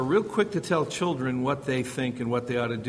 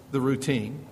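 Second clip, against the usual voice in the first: normal talking voice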